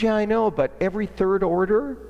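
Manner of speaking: imitating the marketing lady.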